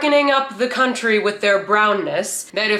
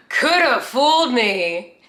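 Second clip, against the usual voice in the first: slow, higher-pitched mock disbelief; sarcastic amazement; 4 semitones higher, slower than usual. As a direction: drag out each word sarcastically. Repeats the sarcastic amazement, drawn out.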